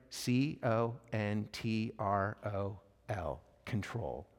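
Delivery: hushed, low, letter-by-letter spelling; slow spelling out. Retold or imitated. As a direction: spell it slowly as if writing it down. Voices Theo nervously writing letters.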